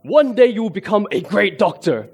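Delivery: booming voice. Retold or imitated. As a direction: proclaim it like a towering general. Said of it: "in his deep booming voice".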